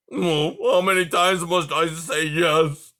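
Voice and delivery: slow, loud porcupine cry; exasperated cry. A narrator's reading. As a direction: cry it out, fed up. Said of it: "cried Aerith".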